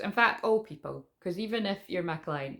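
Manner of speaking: ordinary delivery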